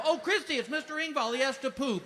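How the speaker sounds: high, cheery announcing voice; high, loud mock cheer; mock announcement. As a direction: announce it brightly to the nurse. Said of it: "there I go".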